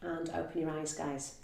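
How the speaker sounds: soft, quiet meditation voice; guided meditation. Acted. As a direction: settle them gently into calm. Guides relaxation after the postures.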